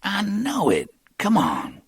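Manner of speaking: lightly voiced young hustler; Cornell's voice.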